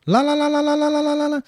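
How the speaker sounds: high monotone la-la babble; imitated repeated syllables. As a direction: repeat it rapidly on one high note, imitating. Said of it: "às vezes a pessoa fica". (lalação aguda num tom só)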